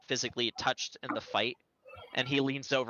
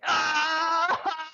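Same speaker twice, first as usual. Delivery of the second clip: high, loud scream; 5 dB louder than usual. Acted. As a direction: scream out in sudden agony as the character. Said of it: "John begins to scream".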